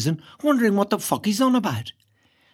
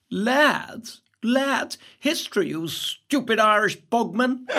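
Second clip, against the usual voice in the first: drawn-out jeer of a class bully; mock the new boy slowly, ending in laughter; slower than usual. A narrator's reading. Class bully to Irish boy, jeering.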